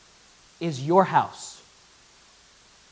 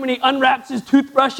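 normal talking voice

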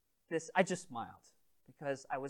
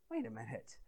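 inner thought; 5 semitones higher, 17 dB quieter than usual. Retold or imitated. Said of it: "And I'm thinking".

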